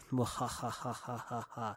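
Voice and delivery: sneering villain cackle; evil laugh. Pretend. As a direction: cackle like a panto baddie. Evil laugh between names.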